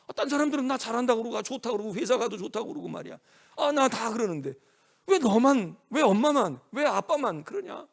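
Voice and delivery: loud, high, indignant protest; resentful child. Acted. Rebellious child complaining to mom and dad, angry protest. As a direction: lash back, hammering each accusation at the end. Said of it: "삐뚤어 나가면 이게 싫은 거야".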